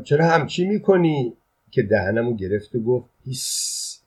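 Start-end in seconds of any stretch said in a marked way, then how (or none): 0.0-1.4 high-pitched protest, no real shout; protesting cry. A narrator's reading. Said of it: "داد زدم". 3.2-4.0 sharp whispered shush; urgent hush. A narrator's reading. Hushing after covering his mouth.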